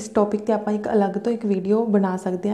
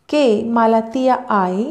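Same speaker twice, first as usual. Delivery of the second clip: very slow, clear Italian question; slow model reading; 3 dB louder, much slower than usual. Shown as a demonstration. Teacher slowly models the Italian question for the class. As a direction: say each word slowly for learners. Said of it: "ਤੁਸੀਂ ਧਿਆਨ ਦਿਓ ਕਿ ਮੈਂ ਕਿੱਦਾਂ ਪੜ੍ਹ ਰਹੀ ਹਾਂ".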